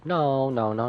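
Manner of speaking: ordinary talk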